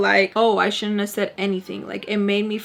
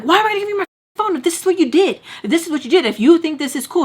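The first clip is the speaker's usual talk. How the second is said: much higher, louder and fast, yelling back; retold angry yell; 8 semitones higher, 5 dB louder, much faster than usual. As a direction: shout back at mom in disbelief. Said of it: "And I'm like".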